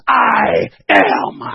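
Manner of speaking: loud, low, drawn-out I AM; weighty, low-pitched proclamation; thundering declaration. Acted. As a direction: boom out each word slowly from the pulpit. Climax of retold scripture.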